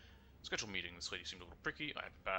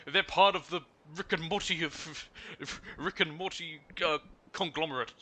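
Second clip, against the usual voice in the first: slow, mock Japanese-accented gangster voice; 8 semitones higher, 5 dB louder, slower than usual. Imitating the Yakuza in a slow mock Japanese accent. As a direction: ham up a Yakuza boss. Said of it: "They'd be like".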